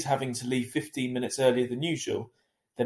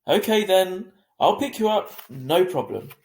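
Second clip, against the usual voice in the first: decisive; 7 semitones higher, 8 dB louder than usual. A narrator's reading. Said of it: "Mum said decisively".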